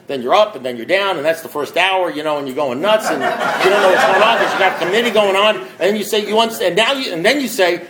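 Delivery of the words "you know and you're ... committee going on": ordinary talk, nothing marked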